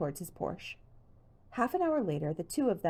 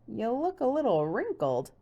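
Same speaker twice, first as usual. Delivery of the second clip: teasing; 6 semitones higher, 4 dB louder than usual. Acted. George teasing.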